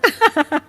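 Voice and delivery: short high cackle; teasing laugh. Acted. Neighbour mocking the daughter-in-law, laughing. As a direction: laugh at your own jibe.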